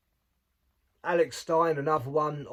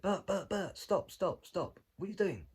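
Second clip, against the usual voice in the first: higher, pleading mock Rogan; exasperated Joe Rogan; 5 semitones higher than usual. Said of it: "He said".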